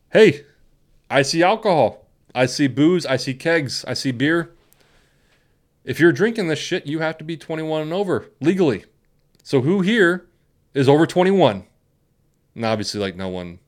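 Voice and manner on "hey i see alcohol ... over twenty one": higher, projected officer's address; announcing cop. Retold or imitated. Imitating a cop addressing lined-up underage drinkers, projected and authoritative. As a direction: lay it out to a crowd, point by point.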